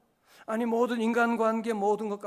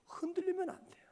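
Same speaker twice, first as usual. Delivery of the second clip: hushed whispered warning; whispered warning to the congregation; 10 semitones higher, 6 dB quieter than usual. Real, not acted. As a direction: lean in and whisper it. Unvoiced aside in sermon.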